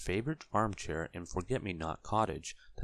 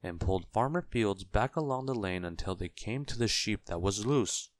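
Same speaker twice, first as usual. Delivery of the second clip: ordinary delivery, nothing marked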